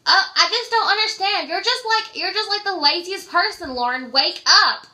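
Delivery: bright nagging caller who woke you; high loud caller voice. Retold or imitated. Friend calling midday, pestering in a high loud voice. Caller berates lazy sleeper.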